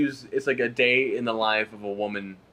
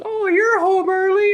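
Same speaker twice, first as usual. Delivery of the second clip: squeaky hammed-up woman, much higher and slow; mock wife voice; 7 dB louder than usual. Ad wife greeting her husband, hammed-up high falsetto. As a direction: play the chirpy wife caught off guard, high and slow.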